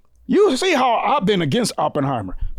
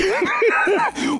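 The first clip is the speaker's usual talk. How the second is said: high shrieking laugh; a big cackling laugh at the memory; 12 semitones higher than usual. Real, not acted. Friend laughing at a film scene, shrieking laugh.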